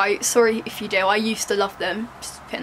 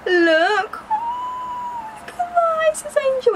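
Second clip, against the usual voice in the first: extremely high, long-held squeal; gushing coo; much slower than usual. Pretend. Vlogger showing off her angel top, high gushing coo. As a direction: stretch it out sky-high in adoration.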